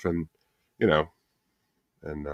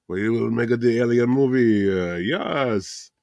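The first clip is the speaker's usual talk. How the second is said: put-on hammy French accent, broken English; French director voice; 3 semitones higher, slower than usual. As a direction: play a pompous French director. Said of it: "the French director".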